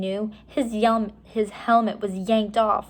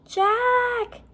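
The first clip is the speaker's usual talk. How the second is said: put-on much higher girl's call, a bit louder; Annie calling; 4 dB louder than usual. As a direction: call his name across the room.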